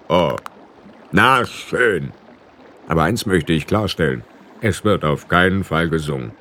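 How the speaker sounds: a stern ferryman putting his foot down; lightly voiced ferryman. A narrator's reading.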